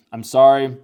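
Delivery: high, loud "I'm sorry" cried out; loud apology. Retold or imitated. Girl apologizing after hitting him, loud high cry.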